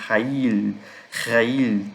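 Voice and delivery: "rail" with throaty r and held L; slow model "rail", falling onto a crisp L; clear final L. Shown as a demonstration. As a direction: say "rail" as RÁ-il, finishing on a true L. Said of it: "esse som assim".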